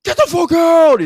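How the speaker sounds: very high, loud rowdy yell; frat bros. Acted. Frat bros shooing the crowd, loud high yell. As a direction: bellow them out the door. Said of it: "they're like".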